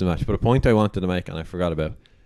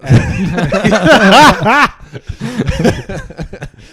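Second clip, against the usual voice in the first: loud high laughing fit; 12 semitones higher, 8 dB louder than usual. Real, not acted. Laughing at Sexton's fall.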